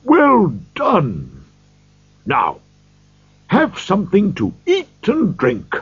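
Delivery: put-on high, jolly old gentleman's cry. A narrator's reading. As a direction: call out heartily to the dancers. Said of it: "old Fezziwig cried out".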